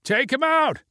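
high mock crowd yell; crowd call. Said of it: "called for Patrick to".

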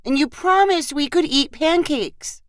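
put-on high little-girl voice; little girl chiming in. A narrator's reading. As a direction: plead like a small child holding Dad to a promise. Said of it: "Sadie echoed".

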